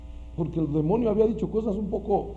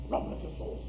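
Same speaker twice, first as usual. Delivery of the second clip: breathy mock whisper; 13 dB quieter than usual. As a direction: whisper it like a nervous believer. Mocking fearful prayer aside.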